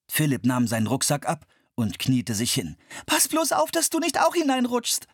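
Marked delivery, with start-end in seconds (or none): 3.1-5.1 put-on high girl's voice, anxious warning. A narrator's reading. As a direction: warn her brother to be careful. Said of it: "warnte ihn Anne".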